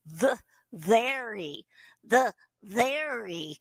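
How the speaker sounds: slow nasal "very" as "thery". Shown as a demonstration. Teacher showing the ventriloquist v. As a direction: say "very" with a th, lips still. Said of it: "spell very as a T H E R Y".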